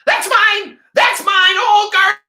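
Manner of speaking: shrill, very high, loud yell; shouting. Acted. Ernest screams at the dog running off with his find, shouting. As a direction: scream after a thief. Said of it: "Ernest shouted".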